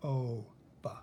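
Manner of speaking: "o" held as a long vowel, then "ba" separately; long O, sounded out. Shown as a demonstration. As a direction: sound out the word in pieces, long "o", pause, "ba". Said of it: "Is the O long?".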